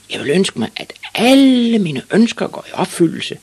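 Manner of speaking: very high, loud triumphant cry; proud shouted wish. A narrator's reading. Ivan proudly repeats his wish to the witch, shouting. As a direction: proclaim it, holding "alle" long and loud. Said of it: "og så meget stolt ud".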